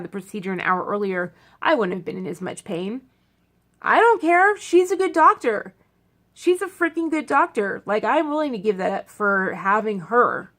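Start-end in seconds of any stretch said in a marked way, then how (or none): 3.8-7.8 loud, high insistence; raised voice defending her doctor; emphatic. Real, not acted. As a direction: insist it doesn't matter, she's worth it. Insisting she'd put up with the pain.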